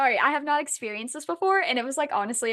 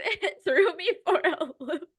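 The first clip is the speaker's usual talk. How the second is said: laughing; 7 semitones higher than usual. Real, not acted. Laughing at the twist.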